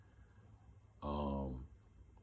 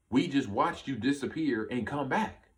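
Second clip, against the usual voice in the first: retold amazement, pitched up and punchy; astonished; 7 semitones higher, 4 dB louder than usual. Mom and kid to the returning man, astonished. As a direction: voice their disbelief higher, each word pushed.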